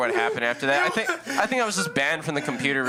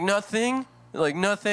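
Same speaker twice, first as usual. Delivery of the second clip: innocent denial; 7 semitones higher than usual. Retold or imitated. Caught kid denying innocently.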